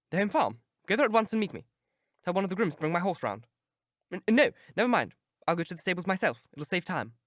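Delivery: a nervous master rushing his servant; urgent Dorian. Acted. Dorian orders the keeper to the home farm, hurried.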